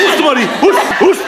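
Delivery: high, sharp shouted dismissal; shooing. Pretend. Host drives contestant away, shouted shooing. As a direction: shoo them off in repeated bursts.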